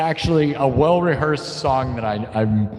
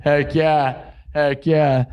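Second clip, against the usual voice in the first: ordinary delivery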